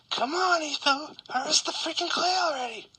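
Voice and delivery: high, whiny mock nagging; squeaky fan complaining; impatient viewer voice. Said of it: "And you're gonna be like".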